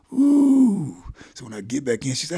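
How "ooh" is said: high, loud drawn-out whoop; body-rush "ooh". Retold or imitated. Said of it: "I can feel my body go".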